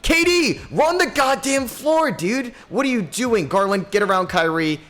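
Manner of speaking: very high, loud scolding shout, slowed on run the floor; angry yell. Real, not acted. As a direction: chew out the lazy player. Yelling at player not running.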